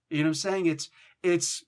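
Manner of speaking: ordinary talk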